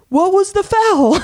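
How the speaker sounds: high, incredulous "what was the foul"; mock-innocent player protesting; squeaky disbelief at the call; player's protest. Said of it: "you see her up close go".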